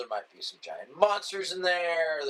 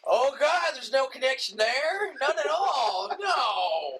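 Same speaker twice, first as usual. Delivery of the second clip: mock disbelief; 4 semitones higher than usual. Mocking a movie preview's giveaway, exaggerated sarcastic disbelief. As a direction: feign astonishment at an obvious plot twist.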